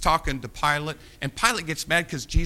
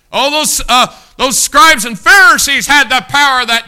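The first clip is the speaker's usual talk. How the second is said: much higher, much louder mock boast; loud proclamation; 12 semitones higher, 19 dB louder than usual. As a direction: trumpet their false power. Mock triumph of Pharisees.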